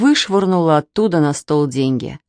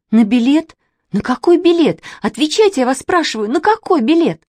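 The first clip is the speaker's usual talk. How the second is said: high, urgent, repeated questions; alarmed, raised-pitch interrogation; agitated demand; 8 semitones higher, 3 dB louder than usual. A narrator's reading. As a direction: insist on an answer, voice climbing. Distraught girl interrogates the children.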